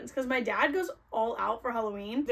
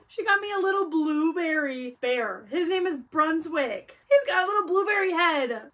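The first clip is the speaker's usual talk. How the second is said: higher, louder, voice cracking with joy; teary excitement; 4 semitones higher, 4 dB louder than usual. Real, not acted. Vlogger introduces blueberry bear from fan, teary gushing. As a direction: gush about the bear, near tears. Describing bear gift, emotional.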